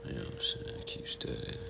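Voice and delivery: hushed aside to viewers; quiet aside. Real, not acted. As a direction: drop away from the mic. Low aside off mic.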